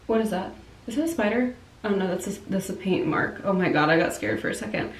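sudden soft startled check; startled, hushed. Real, not acted. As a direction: drop the voice, spooked for a moment.

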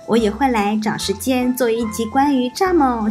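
ordinary delivery

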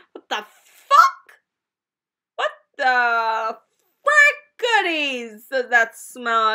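slow, very loud exclamation of disgust; loud outburst. Real, not acted. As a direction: blurt it loudly, stretching the words out. Reacting to a sudden smell.

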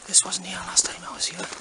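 hushed, creeping horror-film whisper; mock-scared whisper; spooked whisper. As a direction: whisper it as if the killers might hear.

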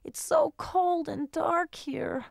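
lost and scared in the dark; scared cat. Acted. Calico Cat answering the dog, frightened.

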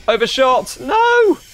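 high, loud cry of dismay. Real, not acted. Player overshooting the station stop, high cry of dismay. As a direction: climb to a high-pitched "no" at the mistake.

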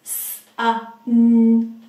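each letter sounded out loud and separate; sounding out words. Shown as a demonstration. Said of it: "Let's sound out sun".

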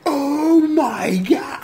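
high, slow, crying-out mock shock; retold shock. Recalling his own reaction, high slow wail. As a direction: wail it in disbelief. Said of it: "I'm like".

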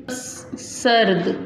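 सर्द said very slowly, र joined to स, ending on a still द; drawn-out model reading. Shown as a demonstration. Teacher shows children how jazm reads, सर्द drawn out and joined. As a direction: stretch सर्द out as one joined syllable. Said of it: "ये आप पढ़ेंगे तो आपको ज़्यादा बेहतर समझ आएगा".

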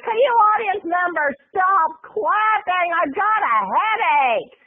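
high, slow, snippy diva demand; mean diva Roseanne. Retold or imitated. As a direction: snap at the crowd like a diva. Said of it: "her diva-ness".

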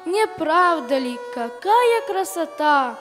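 usual speaking voice

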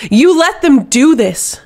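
furious slow bellow at her commander. A narrator's reading. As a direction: roar the accusation, drawn out. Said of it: "Astrid bellowed".

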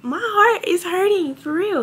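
on the edge of crying, real hurt; heartbroken. Real, not acted. Tearful talk about a breakup.

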